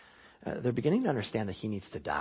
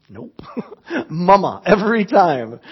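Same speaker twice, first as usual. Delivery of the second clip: laughing; 6 semitones higher, 10 dB louder than usual. Real, not acted. Laughing at daughter story.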